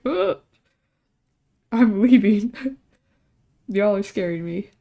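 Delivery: loud, half-laughing mock panic; mock alarm. Joking to coworkers about their scary warning, mock alarmed. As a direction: announce a hammed-up exit.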